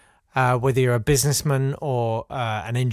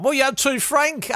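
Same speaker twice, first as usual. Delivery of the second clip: high voice; 11 semitones higher than usual. Retold or imitated. Acted dialogue between leaders.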